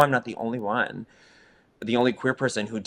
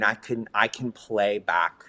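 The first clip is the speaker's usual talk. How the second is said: normal talking voice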